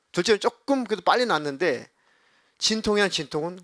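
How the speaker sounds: usual speaking voice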